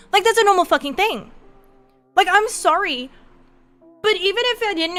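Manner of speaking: exasperated rant. Real, not acted. Ranting about horror movies.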